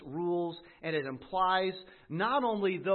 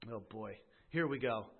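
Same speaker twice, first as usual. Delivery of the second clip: ordinary talk, nothing marked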